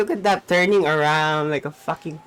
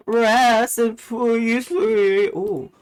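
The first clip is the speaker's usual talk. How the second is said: wailing mourn; 6 semitones higher, 5 dB louder, much slower than usual. Pretend. Dramatic drawn-out mourning.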